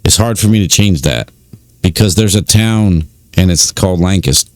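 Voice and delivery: ordinary talk, nothing marked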